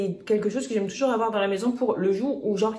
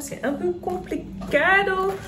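a light borrowed catchphrase, complicado said higher in a put-on voice; like Marty; 8 semitones higher, slower than usual. Quoting a catchphrase to her viewers about picky kids. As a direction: toss off complicado as a playful quote. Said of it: "comme dirait Marty".

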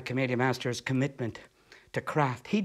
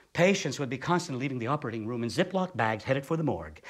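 ordinary talk, nothing marked

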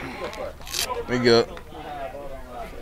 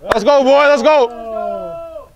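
high shouted rally call; cheering; 8 semitones higher, much slower than usual. Real, not acted. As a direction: pump up the batter. Cheering from dugout.

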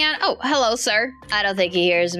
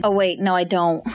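ordinary talk, nothing marked

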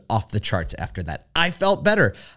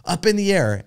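upbeat flight attendant; 10 semitones higher than usual. Retold or imitated. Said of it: "She's like".

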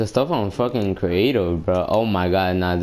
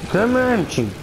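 taunting call; 14 semitones higher, 3 dB louder than usual. Real, not acted. Taunting enemy in game.

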